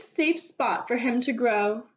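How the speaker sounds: plain reading voice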